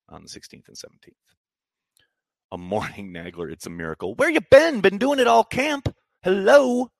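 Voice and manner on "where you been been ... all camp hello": high, loud playful call; teasing call-out. Real, not acted. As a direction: rib a returning viewer loudly. Teasing a returning viewer loudly.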